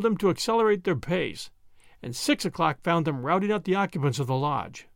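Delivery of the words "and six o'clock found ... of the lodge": usual speaking voice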